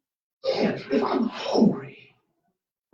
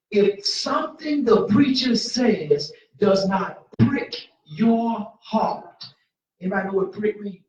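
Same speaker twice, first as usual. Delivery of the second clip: rising preacher's holler; full-force preaching; 8 dB louder than usual. Acted. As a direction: preach it loud to the whole church.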